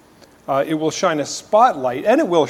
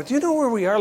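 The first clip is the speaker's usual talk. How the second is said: anxious and higher, unsure; worried question; 9 semitones higher, 3 dB quieter, much faster than usual. Retold or imitated. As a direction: ask nervously, lost in the dark. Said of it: "I'm just saying to my".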